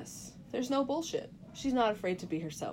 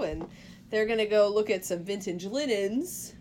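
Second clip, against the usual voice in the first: normal talking voice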